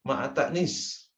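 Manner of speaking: ma'a ta'nis said slowly and deliberately; teacher dictating ma'a ta'nis slowly; slow term demo. Shown as a demonstration. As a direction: lay out ma'a ta'nis word by word for students. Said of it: "Yang keempat".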